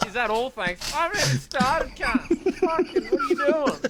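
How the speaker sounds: outraged stranger, played for laughs; laughing mock outrage. Voicing the stranger at the drive-through, laughing and outraged.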